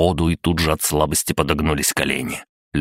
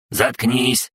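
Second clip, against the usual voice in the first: higher-pitched shouted interruption; robot guard's cry; 7 semitones higher than usual. A narrator's reading. Robot guard interrupting an intruder, crying out. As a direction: cut in with a high, moderate shout. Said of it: "крикнул страж".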